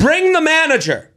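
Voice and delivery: high, pushy demand for the manager; demanding customer. Pretend. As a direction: play the entitled diner. Mocking a demanding diner.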